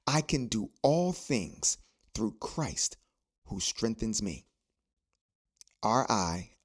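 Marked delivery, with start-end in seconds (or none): 0.1-4.5 each word of the verse laid down slowly; measured scripture recital, leaning on all; slow deliberate recitation. Shown as a demonstration. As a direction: recite it slowly so they can say it with you. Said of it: "Say it with me".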